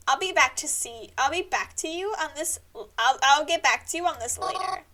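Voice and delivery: usual speaking voice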